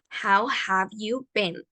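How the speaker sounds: slow, careful English model; slow model phrase. Shown as a demonstration. Teacher modelling slowly for students. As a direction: say it slowly for the class to repeat. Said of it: "Pueden repetir después de mí".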